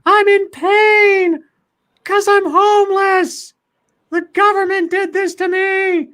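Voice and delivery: very high, loud, drawn-out falsetto; imitated wailing scream. Mimicking the homeless woman screaming her complaints, slow high wail. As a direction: stretch each word into a long wail. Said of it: "And it's like".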